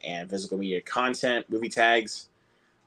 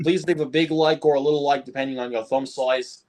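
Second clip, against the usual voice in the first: put-on Australian accent; 5 semitones higher than usual. Streamer repeating his like request in a mock Australian accent. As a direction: ham up a cheerful Aussie plea for likes. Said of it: "that's my Australian accent".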